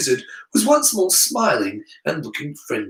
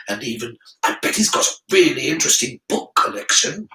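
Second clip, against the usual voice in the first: tempting Slytherin side; 3 semitones higher than usual. Acted. Harry's Slytherin side luring him to stay, coaxing. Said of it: "turning people to the dark side".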